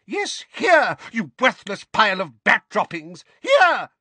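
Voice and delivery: high, loud, furious scolding; shriek of rage. A narrator's reading. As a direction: shriek the insults at the culprit. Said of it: "shrieked Missus Figg".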